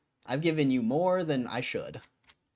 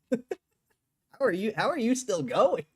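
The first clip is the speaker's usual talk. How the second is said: mock disbelief; 9 semitones higher, 4 dB louder, slower than usual. Real, not acted. Mocking chatter after laughing.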